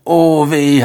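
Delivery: scolding. Acted. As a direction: grumble out loud in dismay. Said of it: "habe ich geschimpft".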